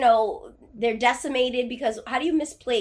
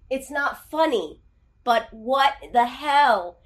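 normal talking voice

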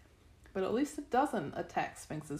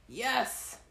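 triumphant raised-voice yell; 4 semitones higher than usual. A narrator's reading. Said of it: "Sadie yelled".

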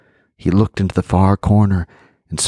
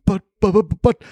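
stuttering; 11 semitones higher than usual. Acted. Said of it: "the man stuttered".

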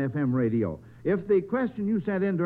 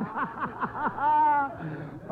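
delighted chuckle from the quiz host; hearty laugh; 8 semitones higher than usual. Real, not acted. Host amused by unexpected answer.

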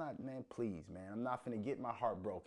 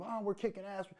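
high, sing-song mock of a bragging fan; mocking smug fan; 9 semitones higher than usual. Fan mocks Bengals fans bragging, high mocking voice. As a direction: squeak it like a gloating fan. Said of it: "when you hear people saying".

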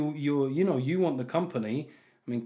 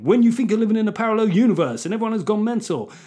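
high mock garage MC accent; hammed-up garage MC voice, pushed up high; 5 semitones higher, 4 dB louder than usual. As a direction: chant it like a put-on UK garage MC. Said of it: "let's do this in a garage accent".

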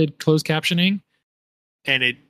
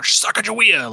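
mock shout; 9 semitones higher than usual.

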